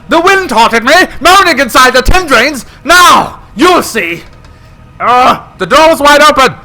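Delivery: high, loud terrified telling; frantic, fearful narrating; panicked narration. Acted. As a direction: tell it in rising panic.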